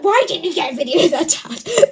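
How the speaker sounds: half-joking indignant yell at dad; indignant outcry. Real, not acted. Teen exclaims at her dad.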